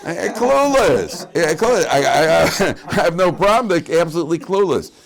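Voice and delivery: high, loud words breaking into laughter; real laughing. Real, not acted. Rabbi cracking up over his own true story, laughing.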